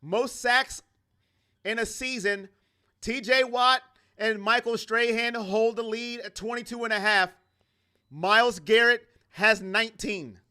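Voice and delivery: mild broadcaster tone; stat read like a broadcast. Acted. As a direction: read the numbers like a TV graphic. Reading stats like a broadcast.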